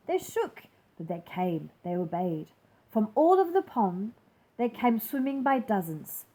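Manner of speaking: plain reading voice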